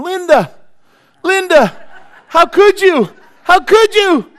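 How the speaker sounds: very high, loud, strained desperate cries; frantic wailing. Retold or imitated. Second asylum patient shrieking at Linda, very high and loud. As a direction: shriek out his grief at the top of the voice.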